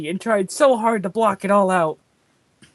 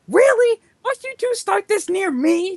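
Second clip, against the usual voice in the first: high, loud exasperated scream; scandalized shriek that settles a little at the end; 11 semitones higher, 4 dB louder than usual. A narrator's reading. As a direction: yell it up high, outraged. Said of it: "Jakai screamed".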